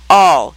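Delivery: "All" pitched low and loud; great emphasis. A narrator's reading. The king repeats "All" to Alice with great emphasis. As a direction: lean hard on "All", dropping the pitch. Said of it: "he repeated with great emphasis".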